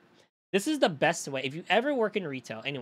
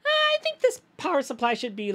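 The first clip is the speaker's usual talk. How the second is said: high mock customer voice; 12 semitones higher, 6 dB louder than usual. Mimicking a customer's price guess.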